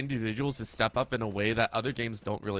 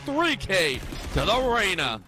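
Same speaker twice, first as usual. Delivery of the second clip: rising into a shouted hype call; excited play-by-play; 10 semitones higher, 5 dB louder than usual. Real, not acted. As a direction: build up to a roaring clutch call. Caster hype on clutch play.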